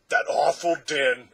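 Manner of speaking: loud, slow, emphatic answer. A narrator's reading. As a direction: call out the answer firmly. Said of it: "answered Tock".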